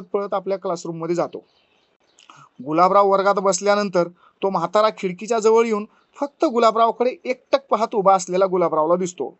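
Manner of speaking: plain reading voice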